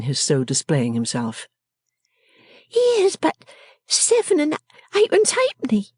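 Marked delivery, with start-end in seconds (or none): 2.7-5.9 very high, indignant character voice; indignant exclamation. A narrator's reading. Miss Wren finding too little money, high indignant exclamation. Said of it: "exclaimed Miss Wren".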